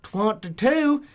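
put-on host the show like a good ol' country boy; country accent. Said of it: "every week you come up with a, with a different accent".

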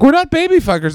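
high, loud protest; indignant mock protest. Accused side answering the president, high and loud mock protest. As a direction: squeal back a mock-offended denial. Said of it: "And they're like".

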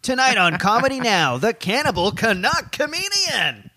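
announcer voice. Pretend. Show intro announcer voice.

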